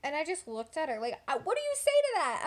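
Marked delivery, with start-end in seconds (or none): 1.3-2.4 high, fast, stunned squeak of disbelief; baffled squeak. Retold or imitated. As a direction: squeak it out, totally thrown. Shocked reaction to sister.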